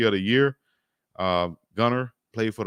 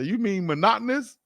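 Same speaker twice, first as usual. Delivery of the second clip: teasing correction; 9 semitones higher than usual. Retold or imitated. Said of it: "Malika Andrews started laughing at it".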